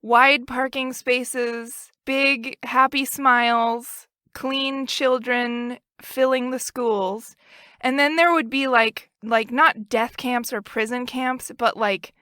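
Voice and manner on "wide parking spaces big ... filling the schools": slow, idyllic listing; slow dreamy. Acted. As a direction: paint it slowly like an ad.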